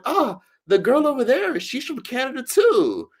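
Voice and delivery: very high, sing-out excited announcement; excited tour guide. Retold or imitated. Tour guide telling her group about a fellow Canadian, excited.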